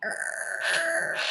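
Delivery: soft unvoiced creak like a floor; quiet creaking floor sound; creaking noise. Shown as a demonstration. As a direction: breathe out a creaky err. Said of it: "Make a creaking noise with me".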